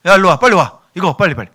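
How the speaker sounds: acted hurried summons; urgent calling. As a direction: call your kids over in a rush. Acts out hustling own family over.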